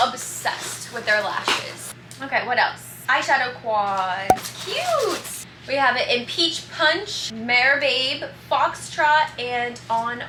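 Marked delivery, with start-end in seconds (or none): 4.6-5.4 squeal. Real, not acted. Reacting to eyeshadow quads.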